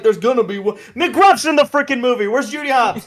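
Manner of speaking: high, loud hammed-up fan demand; a lightly voiced, excited fan searching the screen; mock excited outburst. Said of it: "I'm like".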